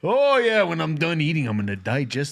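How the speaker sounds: folksy Canadian, lightly voiced; Canadian accent. Podcast host asked to talk Canadian, light Canadian accent. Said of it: "every time I talk Canadian".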